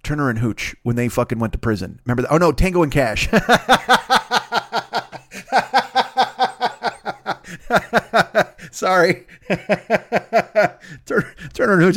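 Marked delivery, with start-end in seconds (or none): 3.3-8.7 long high burst of real laughter; cracking up, can't stop; laughing. Real, not acted. Bursts out laughing at his mixup. 8.7-11.9 real laughter breaking into the words; laughing through words. Real, not acted. Still laughing while correcting himself.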